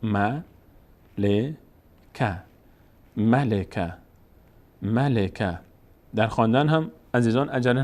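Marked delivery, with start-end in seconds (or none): 0.0-2.3 "م، ل، ک" as separate ma, le, ka; letter by letter. Shown as a demonstration. Teacher reading each letter with its vowel, syllables apart. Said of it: "اولش حرف به حرف". 3.2-5.6 "ملک" linked as ma-le-ka; joined up. Shown as a demonstration. Teacher telling learners to join afterwards, syllables connected. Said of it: "بعد متصل به هم بخونید".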